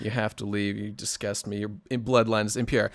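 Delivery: low, hushed mock contempt; mock disgust. Host rejecting his Irish guest, quiet mock disgust. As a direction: banish them softly, in pretend disgust.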